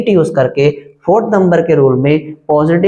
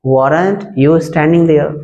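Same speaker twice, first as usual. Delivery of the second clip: "weren't you standing there" said slowly twice; slow teaching read; much slower than usual. Shown as a demonstration. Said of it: "repeated slowly for learners".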